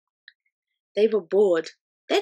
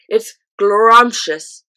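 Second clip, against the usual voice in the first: slow, louder, savouring the word; relishing giant; 2 semitones higher, 11 dB louder, much slower than usual. A narrator's reading. Giant praising his drink, slow relishing giant. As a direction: roll it out with delight.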